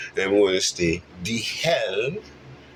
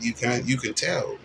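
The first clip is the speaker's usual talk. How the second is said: ordinary delivery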